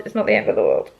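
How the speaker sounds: deep put-on voice. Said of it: "repeated in a deep put-on voice".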